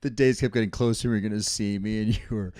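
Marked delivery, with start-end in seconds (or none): none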